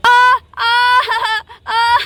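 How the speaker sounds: strained cries under a heavy weight; pained groans. Acted. Mamta pinned by the cow, groaning in pain. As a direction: groan as if crushed.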